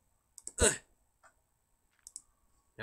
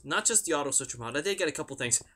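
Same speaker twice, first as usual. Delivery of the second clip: ordinary delivery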